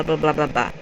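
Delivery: usual speaking voice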